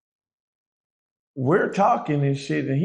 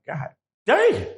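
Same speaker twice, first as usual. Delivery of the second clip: stunned; 10 semitones higher than usual. Retold or imitated. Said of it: "So I'm like".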